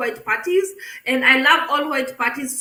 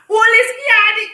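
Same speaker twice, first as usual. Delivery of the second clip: loud gossip call; 8 semitones higher, 7 dB louder, faster than usual. Retold or imitated. Friends calling each other loudly.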